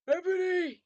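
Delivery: loud, high villain yell. A narrator's reading. Voldemort calling Ebony, loud high yell. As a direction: bellow the name out. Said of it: "he yelled".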